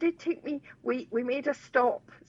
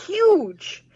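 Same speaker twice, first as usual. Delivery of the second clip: huge said higher and louder, stretched; emphatic huge; 10 semitones higher, 7 dB louder than usual. Real, not acted. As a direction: hit the word huge hard and high. Describing friend's enormous car.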